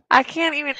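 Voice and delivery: words squeezed out through laughter; too tickled to get started; laughing. Real, not acted. Cracks up attempting the accent.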